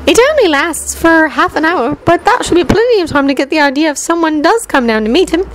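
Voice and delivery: put-on high, eager know-it-all girl lecturing; Hermione's voice. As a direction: explain it proudly like the top student.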